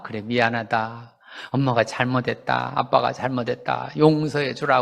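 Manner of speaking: humble apology. Retold or imitated. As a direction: ask the child's forgiveness gently and low. Parent apologizing to the child.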